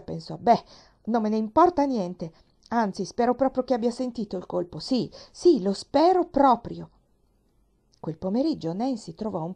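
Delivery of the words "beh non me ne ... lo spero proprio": higher and louder, defiant; building spite up to the last lo spero proprio; angry. A narrator's reading. Nancy thinks defiantly about the slammed door, angry and louder.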